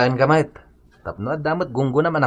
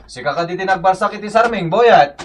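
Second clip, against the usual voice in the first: scolding; 8 semitones higher, 4 dB louder than usual. Acted. Neighbor scolds boy over window.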